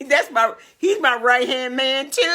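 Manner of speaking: high, laughing delivery. Real, not acted. Proud mother telling viewers about her son, laughing. Delighted by her son's comment.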